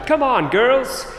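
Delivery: high, drawn-out calling voice; mock a slow, high-pitched holler; imitated shout. Female farmer calling her own sheep, imitated shout. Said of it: "she stood up and shouted".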